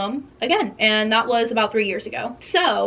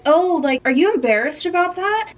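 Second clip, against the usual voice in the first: high, sugary fake sympathy, sharply raised; mock concern; 7 semitones higher than usual. Frenemy taunting about being poor, high sugary mock concern. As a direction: needle her with fake concern, pitched way up.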